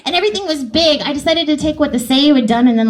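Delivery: ordinary talk, nothing marked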